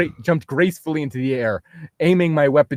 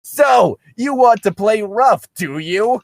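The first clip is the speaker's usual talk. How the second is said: much louder, higher, slow villain taunt; hammed-up villain shout; 8 semitones higher, 5 dB louder, slower than usual. Reading Darklink's challenge to Link, hammed-up loud slow villain. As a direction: bellow it like a cartoon baddie.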